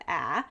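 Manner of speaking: "a" as in mad, on its own; isolated short "a" sound; short vowel sound. Shown as a demonstration. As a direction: say "a" as the short vowel, not the letter name. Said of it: "short vowel sound".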